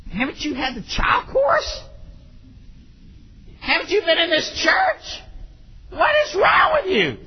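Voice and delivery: high, incredulous scolding holler; mock outrage. Preacher wanting to scold arguing parent, mock outrage. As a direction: scold as if you can't believe it.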